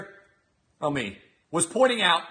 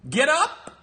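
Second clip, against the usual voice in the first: mock title; 8 semitones higher, 4 dB louder than usual. Mocking the show title.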